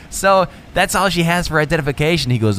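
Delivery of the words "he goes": normal talking voice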